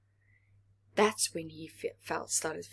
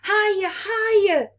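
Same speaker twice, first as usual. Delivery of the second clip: high, loud eager calls; excited shout; 12 semitones higher, 6 dB louder than usual. Acted. Little planets shouting from the swings, excited high calls. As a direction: squeal for more push. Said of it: "they shouted".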